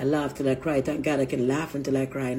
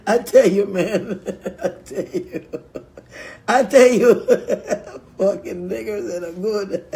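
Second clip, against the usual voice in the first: loud, laughing exclamations; 2 semitones higher, 5 dB louder, slower than usual. Real, not acted. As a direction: burst out between real laughs. Cracking up at own remarks.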